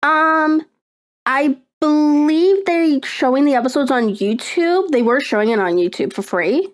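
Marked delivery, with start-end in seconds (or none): none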